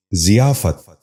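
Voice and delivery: ضیافت starting with "zi", "zi-yaa-fat"; correct pronunciation. Shown as a demonstration. Teacher giving viewers the model, correct pronunciation. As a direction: say ضیافت as ziyaafat. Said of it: "غور سے سنیے".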